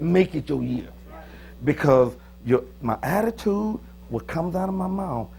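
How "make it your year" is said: weighty preacher emphasis, MAKE leading; preacher talk. Acted. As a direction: drive home "Make it your year" like a preacher. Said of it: "I don't know no preacher talk like that".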